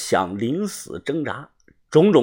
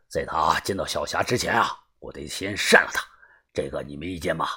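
lower, softer, menacing; quietly threatening boss; grim boss; 9 semitones lower, 7 dB quieter than usual. Acted. Boss telling the narrator he will slap the captive, low and menacing. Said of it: "帮主阴着脸说".

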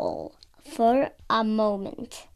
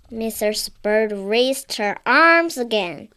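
plain reading voice

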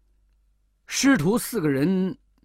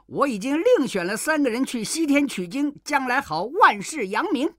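boastful monkey; 7 semitones higher than usual. A narrator's reading. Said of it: "行者说".

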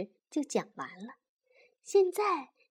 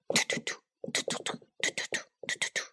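noise imitation; 6 dB quieter, much faster than usual. A narrator's reading.

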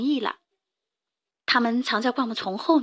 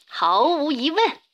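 bear; 4 semitones higher, slower than usual. A narrator's reading. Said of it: "熊说".

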